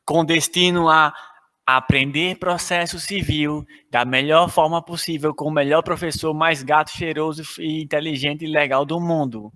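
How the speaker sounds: low, formal flight announcer voice; over the intercom. Pretend. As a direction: airport boarding call announcement. Mock airline boarding announcement.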